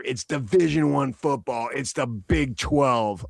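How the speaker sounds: ordinary talk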